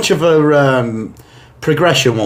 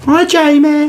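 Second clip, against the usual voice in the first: high, louder mock-flirty hello; 12 semitones higher, 4 dB louder, slower than usual. Teasing his co-host, imitating a stunning lifeguard's flirty greeting.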